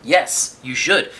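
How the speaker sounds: usual speaking voice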